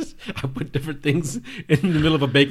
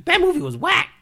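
very high, puppet-like Elmo voice; high squeaky puppet complaining; 3 dB louder than usual. Pretend.

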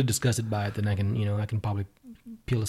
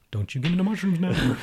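higher-pitched mock teacher's warning; 8 semitones higher than usual. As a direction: playfully scold like a concerned teacher. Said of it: "her teacher was trying to warn her".

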